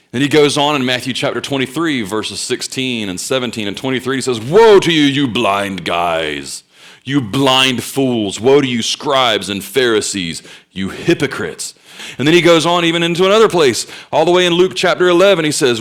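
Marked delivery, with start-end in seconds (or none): 4.4-11.7 slow, drawn-out preacher's denunciation; thundered opening, slowing into heavy condemnation; dropping the hammer. Retold or imitated. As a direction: hit the opening hard, then stretch each accusation. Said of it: "dropped the holy hammer down".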